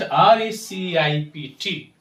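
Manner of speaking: ordinary delivery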